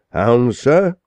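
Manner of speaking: indignant interruption; impatient outburst. Acted. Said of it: "burst in impatient Joshua".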